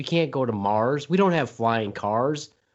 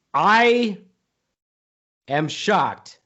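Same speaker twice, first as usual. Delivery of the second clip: dramatic loud opener; 4 semitones higher, 5 dB louder, much slower than usual. Acted. Dramatic opening of statement.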